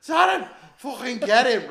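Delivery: high, loud call for help; mock shout. Mate pinned by fighter yells to Darren, loud. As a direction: yell at your mate to grab him. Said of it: "me mate went".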